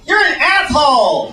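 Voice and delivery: high-pitched camp indignation. Acted.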